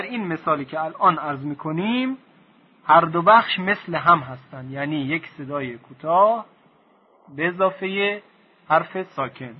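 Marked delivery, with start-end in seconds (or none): none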